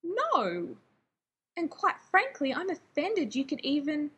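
high outraged no, quite frankly offended; horrified. Acted. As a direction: recoil in shocked denial. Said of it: "looking horrified".

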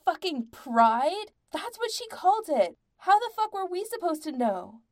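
high, loud, indignant protest; horrified shouting. A narrator's reading. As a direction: yell back with mounting horror.

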